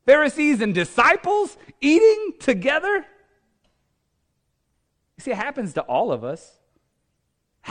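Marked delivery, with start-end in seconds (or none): 0.0-3.1 higher, building disbelief; appalled objection, climbing to a high incredulous finish; incredulous protest. Acted. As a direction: protest in rising, pressing questions. Ananias voiced protesting to God.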